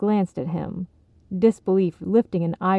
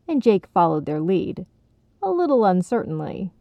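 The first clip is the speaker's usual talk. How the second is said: ordinary delivery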